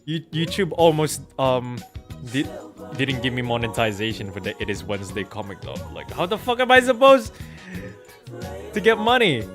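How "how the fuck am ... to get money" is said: loud, high-pitched exasperated demand; exasperated outburst. Real, not acted. Streamer defending money choices to viewers, exasperated. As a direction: raise voice and pitch in indignant frustration. Ranting about losing monetization.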